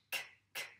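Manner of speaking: "k" as a bare voiceless click; isolated k sound. Shown as a demonstration. Teacher isolating the sound for a pupil, bare "k". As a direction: say "k" alone, no vowel after it. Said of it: "c'est le son k".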